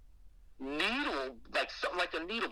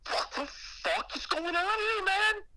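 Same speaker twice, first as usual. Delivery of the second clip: panicked and lost, voice climbing; frantic high yell. Retold or imitated. Back in the nightmare room, a frantic high yell. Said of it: "I'm like".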